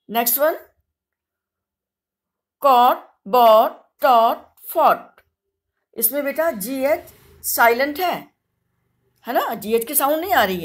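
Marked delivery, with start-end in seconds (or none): 2.6-5.2 "caught, bought, taught, fought" said louder, every gh silent; clear model words. Shown as a demonstration. Teacher reading the word list aloud, louder and clear. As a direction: model "caught" as kawt, spelt with no gh sound. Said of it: "some words you have to say aloud".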